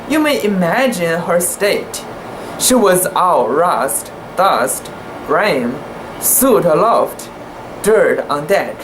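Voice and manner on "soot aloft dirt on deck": ordinary delivery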